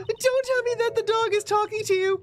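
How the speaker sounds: squeaky nervous character; high character voice. Acted.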